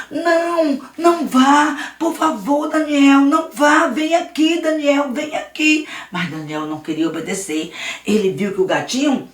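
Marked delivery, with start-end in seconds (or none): 0.1-5.9 higher, louder urgent begging; urgent pleading. Acted. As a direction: call him back in alarm. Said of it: "E Luiz pediu".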